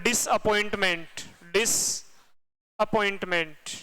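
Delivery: Disappointment stretched slowly, every syllable laid out; slow, drawn-out name. Shown as a demonstration. Teacher spelling out the lake name slowly for students. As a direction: draw out disappointment for the class.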